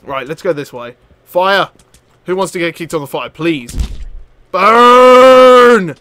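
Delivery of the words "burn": long, loud, raised war cry; gleeful yelling. Real, not acted. Player kicking pirates on the fire, gleeful yelling. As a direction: holler it, stretching each word.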